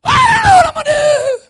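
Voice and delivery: very high, loud panicked wail; hammed-up cry of helplessness; mock panic. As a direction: wail to the preacher as if all is lost. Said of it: "the next time they hit a bump".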